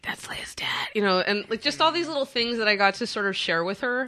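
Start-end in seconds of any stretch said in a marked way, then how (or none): 0.0-0.9 hushed whisper. Retold or imitated. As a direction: whisper it secretly. Whispering aside in the movie.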